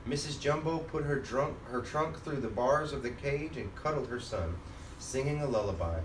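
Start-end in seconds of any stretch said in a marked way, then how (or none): none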